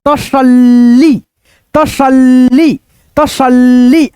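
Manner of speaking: तसल्ली slowly, holding the double ल long; drawn-out double l. Shown as a demonstration. Teacher showing students how to read तसल्ली, drawn-out double l. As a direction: stretch the ल्ल in तसल्ली before the final ली. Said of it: "कैसे बोलेंगे ... ऐसे करके".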